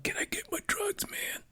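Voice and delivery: hushed, breathy ask for the drugs; imitating a hushed drugstore request; mock whisper. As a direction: whisper it like a shady deal. Retelling asking the CVS guy.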